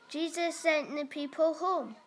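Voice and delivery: ordinary delivery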